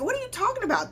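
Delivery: high, soft mock brain voice; voicing the brain. Coach voicing your brain resisting change, mock. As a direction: play the brain as lightly indignant. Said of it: "your brain is like".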